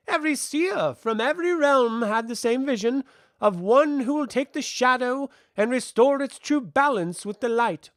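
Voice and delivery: put-on high, loud, declamatory child figure; child envoy, insistent. A narrator's reading. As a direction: press the prophecy on her in a ringing voice.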